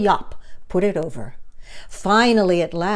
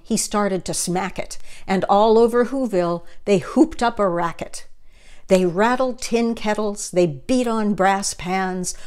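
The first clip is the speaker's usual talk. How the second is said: ordinary delivery, nothing marked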